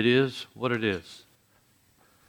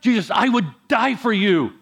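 raised, high-pitched indignant vow; upset protest; 9 semitones higher, 4 dB louder than usual. Retold or imitated. Peter swearing loyalty to Jesus, upset and insistent. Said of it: "I think Peter was upset with Jesus".

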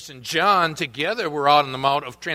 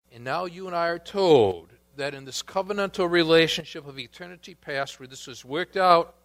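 ordinary delivery

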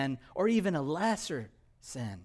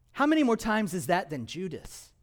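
ordinary talk

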